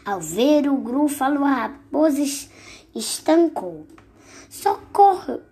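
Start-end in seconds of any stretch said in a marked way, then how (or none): 4.5-5.4 much higher, louder yelp of socorro; a storybook scream, big but playful; shouting for help. Acted. Fox screams for help on seeing the Gruffalo, loud cry.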